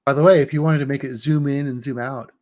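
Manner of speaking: ordinary delivery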